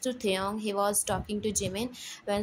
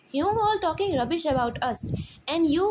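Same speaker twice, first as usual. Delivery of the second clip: loud, higher and quick; angry accusation; 5 semitones higher, 5 dB louder, faster than usual. Acted. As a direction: hurl the blame at them. JK angrily blames them.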